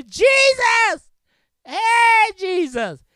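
high loud call. Shown as a demonstration. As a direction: shout his name across the crowd. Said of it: "You can say".

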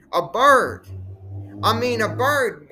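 high, loud, incredulous exclamation; loud, high-pitched indignation; indignant disbelief. Real, not acted. Indignant retelling of rude gesture.

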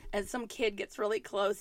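ordinary delivery

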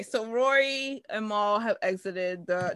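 normal talking voice

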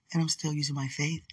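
whispered aside; breathy whisper, no voice. Real, not acted. Drops into a whisper.

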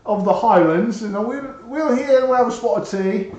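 high, lilting English accent. Shown as a demonstration. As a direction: put on a playful English accent. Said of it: "if I do an English accent".

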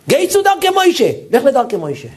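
sudden very high burst of the rabbi's verdict; the rabbi declares it all at once; rabbi's ruling. Retold or imitated. הרב שך פוסק נחרצות. (פסיקה נרגשת בקול גבוה מאוד של הרב)

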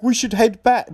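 mock whiny passenger. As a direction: parody the silly suggestion. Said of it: "It's just like".

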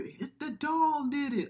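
high, drawn-out mock child voice; slow, high-pitched kid protest; kid pleading his case. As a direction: imitate a boy blaming his toy. Acting the kid blaming the doll.

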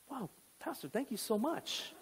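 gasp, then very soft thanks; relieved woman. Retold or imitated. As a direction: imitate her awed, hushed gratitude. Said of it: "And she went".

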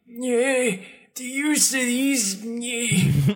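high-pitched cranky cartoon complainer; hammed-up high, slow grumbling; mock MLB. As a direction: caricature a fussy scold in a high voice. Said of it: "accurate depiction of the M L B".

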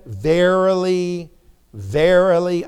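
slow, drawn-out "Verily", said twice with a pause; emphatic repetition. Retold or imitated. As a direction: stretch each "verily" solemnly. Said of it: "It's a point of emphasis".

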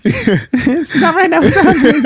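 high, slow, laughing squeal; laughing mock yell. Fiancé echoing his proposal shout, high and laughing. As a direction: stretch it out through laughter. Joins the reenactment.

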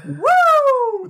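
very high-pitched whoop; laughing whoop. Real, not acted. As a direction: let out a high whoop of amusement. Whooping amid laughter at the accent.

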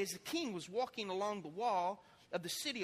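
usual speaking voice

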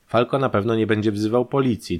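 ordinary delivery, nothing marked

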